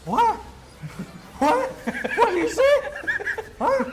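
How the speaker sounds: high, loud shocked "what?"; high, loud incredulous squeal; shocked disbelief. Real, not acted.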